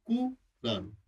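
Quran broken as कु, pause, रान; split into syllables. Shown as a demonstration. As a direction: separate Quran into its two parts. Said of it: "कु माने आकाश".

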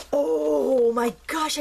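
slow, drawn-out delighted exclamation; excited amazement. Real, not acted. Crafter realizing the crayons came out, excited amazement. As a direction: stretch it out in thrilled surprise. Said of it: "totally gets me excited".

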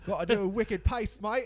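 put-on loud, slow mix of Boston and Australian accents; mock Boston accent. Co-host copying a failed attempt, mock Boston accent. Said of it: "Yeah, that's Boston".

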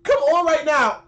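loud, slow "Come on right now!"; exasperated drawn-out shout. Real, not acted. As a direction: yell at the screen to get up. Urging player up.

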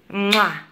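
kissing sound. Real, not acted. Kisses baby.

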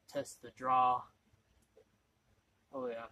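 talking around pipe stem; pipe in mouth. Real, not acted. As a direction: lips clamped on stem. Seen 0: Pipe stem held between lips, testing draw, from 0.8s.

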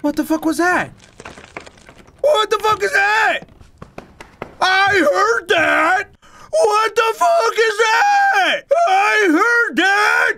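high, slow, drawn-out frightened yells; scared shouting. Real, not acted. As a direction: scream at the unseen noise. Startled by a noise.

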